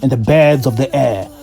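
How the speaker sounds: slow, measured travel narration; reflective voiceover. A narrator's reading. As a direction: tell it like a closing voiceover.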